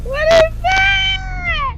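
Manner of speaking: high, drawn-out and loud; spooked cry at something on screen; terrified. Real, not acted. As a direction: stretch it out in high fear. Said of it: "I'm so scared right now".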